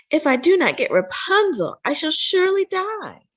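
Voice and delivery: high, breathy-free plea of a sick wife; wife pining for Rapunzel, high and pleading. A narrator's reading. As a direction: moan it up high like you're pining.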